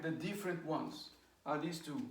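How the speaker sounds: usual speaking voice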